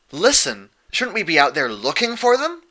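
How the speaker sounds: high, loud protest. A narrator's reading. Dash protesting to Celestia about waiting. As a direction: push back, impatient. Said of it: "Dash protested".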